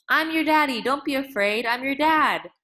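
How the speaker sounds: calm her while she fights you; desperate reassurance. A narrator's reading. Father holding struggling crying child.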